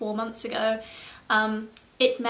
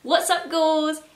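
excited greeting; 6 semitones higher, 7 dB louder, slower than usual. Real, not acted. Vlog opening greeting to viewers.

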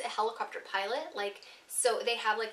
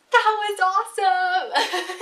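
slow, drawn-out, loud and high cheer; elated whoop, much louder and higher; thrilled yell; 9 semitones higher, 10 dB louder, much slower than usual. Retold or imitated. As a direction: shout it out, euphoric. Surfacing thrilled after the jump.

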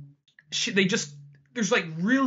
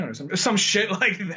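ordinary talk, nothing marked

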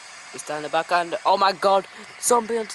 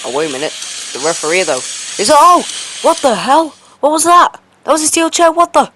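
screaming shock; 10 dB louder than usual. Real, not acted. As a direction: erupt at the surprise attack. Surprise chair attack after match.

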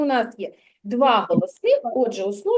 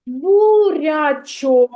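drawn-out echo; 5 dB louder, much slower than usual. Shown as a demonstration. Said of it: "teacher echoes answer".

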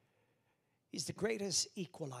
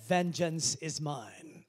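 slow, loud, heavy Vengeance is mine; slow solemn quote; 6 dB louder, much slower than usual. Acted. Pastor quoting God to the church, slow and loud. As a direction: intone it slowly.

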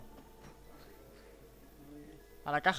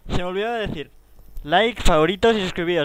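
much louder hurried sign-off; loud outro; 3 semitones higher, 13 dB louder than usual. Real, not acted. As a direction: shout the subscribe plea. Outro plea to subscribe.

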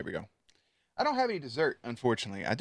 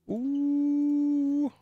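high impressed ooh. Real, not acted. Reacting to shop update.

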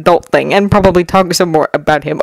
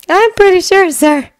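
put-on higher, eager young voice; young boy voice; 12 semitones higher than usual. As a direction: voice him like a nervous teen. Izuku voiced by narrator.

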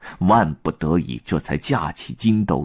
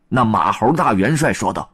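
ordinary delivery, nothing marked

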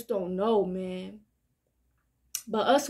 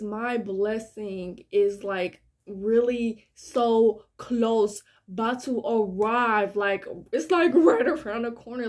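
ordinary talk, nothing marked

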